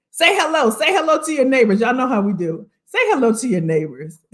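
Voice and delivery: ordinary delivery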